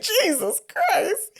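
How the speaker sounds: very high, drawn-out squeal; mock exclamation. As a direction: stretch it out in a mock falsetto. Mock exasperated reaction to the line.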